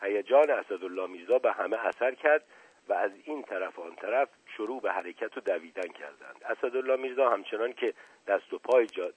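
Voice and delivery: plain reading voice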